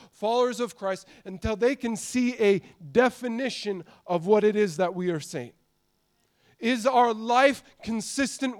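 a fiery preacher rousing the room; preaching at full force. Acted. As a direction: preach it out loud to the whole church, pressing each point.

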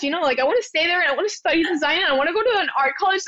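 fast nervous babble; nervous rambling. Retold or imitated. Nervous applicant babbling her life story to the clerk, fast. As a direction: rush it all out, talking too much. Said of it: "when I'm nervous, I talk a lot".

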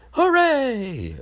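high mock cheer of victory; squealed hooray. Self-cheer as president.

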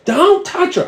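very high-pitched mock shriek; retold scream. Counter lady stops him checking the pulse, mock scream. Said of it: "She fucking screams at me".

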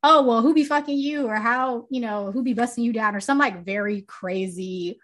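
usual speaking voice

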